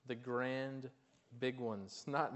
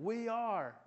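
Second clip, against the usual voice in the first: higher, louder rally call; mock yell; 9 semitones higher, 5 dB louder than usual. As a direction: call out like a coach at a pep rally. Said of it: "Joe Paterno yelling".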